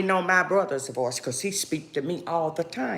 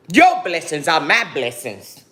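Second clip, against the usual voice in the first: normal talking voice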